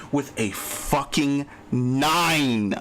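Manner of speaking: each word dragged out slowly, bitter; slow emphatic complaint. Real, not acted. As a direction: spell out the grievance word by word, slowly. Repeats his complaint, dragging it out.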